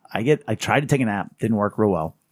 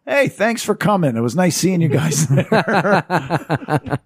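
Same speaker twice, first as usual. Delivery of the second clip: mock funeral host; 6 semitones higher, 3 dB louder, much slower than usual. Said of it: "And Bob goes".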